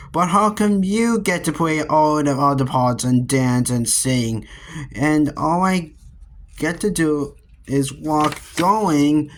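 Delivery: plain reading voice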